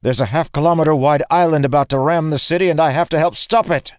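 high, loud, rushed explanation; desperate urgency. A narrator's reading. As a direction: blurt it out urgently under attack. Dalen said desperately.

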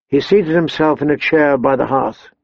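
ordinary delivery, nothing marked

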